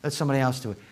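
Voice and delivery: low, sulky grumble of the lazy self; mock a reluctant, stubborn inner voice; lazy flesh voice. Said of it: "my flesh goes".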